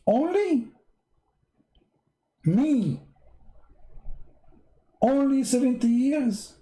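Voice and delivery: high indignant mock outrage; mock outrage over a short paradise; mock disappointment. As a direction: whine it like a cheated customer. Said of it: "I'm disappointed".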